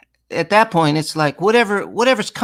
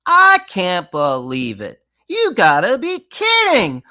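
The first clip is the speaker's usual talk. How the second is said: slow then much higher, louder disbelief; mock disbelief; 4 semitones higher, 4 dB louder, slower than usual. Shown as a demonstration. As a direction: swoop the pitch in mock shock. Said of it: "That's how we talk".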